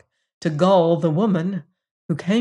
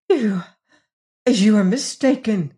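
higher, halting denial; stammering; 3 semitones higher than usual. A narrator's reading. Lady drawn into defending herself, higher halting stammer. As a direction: falter on the first word, then protest. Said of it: "stammered Missus Delamere".

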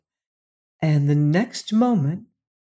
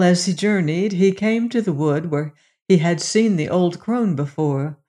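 ordinary delivery

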